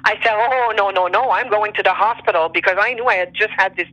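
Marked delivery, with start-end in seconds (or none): none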